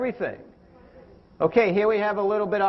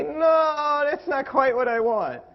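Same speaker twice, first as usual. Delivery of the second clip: higher, drawn-out "no" of a picky eater; mock reluctant refusal; 6 semitones higher, slower than usual. Dieter turning down the coach's celery, mock reluctant. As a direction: imitate a dieter hedging.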